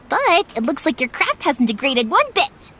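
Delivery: ordinary talk, nothing marked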